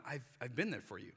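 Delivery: soft, low, reassuring voice of God; God gently reassuring the fearful believer; gentle God. Retold or imitated. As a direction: quietly remind them you never left. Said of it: "and God's going".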